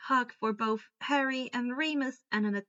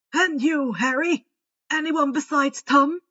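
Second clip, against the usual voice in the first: lightly voiced old wizard, a touch louder; kindly headmaster; 3 semitones higher, 8 dB louder than usual. A narrator's reading. Headmaster sending students home, lightly voiced and louder. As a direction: give the orders gently but firmly. Dumbledore questions Harry.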